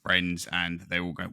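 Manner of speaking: ordinary delivery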